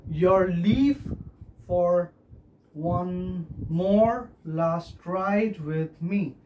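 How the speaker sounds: very slow, broken into feet; measured metrical demonstration; scanned verse. Shown as a demonstration. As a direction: pause after "leave" and "for", stretching each beat. Teacher scans verse foot by foot.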